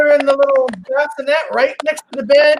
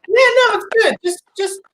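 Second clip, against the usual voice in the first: 1 breezy nurse; 8 semitones higher than usual. Retold or imitated. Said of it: "the nurses are like".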